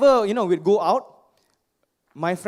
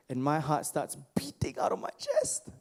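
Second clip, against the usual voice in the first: hushed, breathless whisper, softer; nervous whisper; 3 semitones lower, 6 dB quieter than usual. Acted. A boy watches his crush walk towards him, nervous whisper. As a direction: whisper it as if your heart is pounding.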